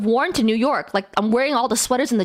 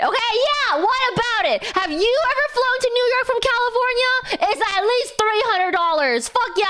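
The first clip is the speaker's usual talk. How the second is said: defiant retort; 9 semitones higher than usual. Acted. Defiant reply to heckler.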